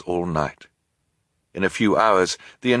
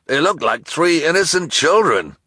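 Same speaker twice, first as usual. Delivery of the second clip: higher, louder shopkeeper; 9 semitones higher, 5 dB louder than usual. Acted. Shopkeeper answers loudly.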